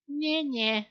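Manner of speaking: high sing-song nyeh nyeh; taunting. Retold or imitated. Girl taunting the boy from a distance, high mock taunt. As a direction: tease like a bratty little girl. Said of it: "she taunted".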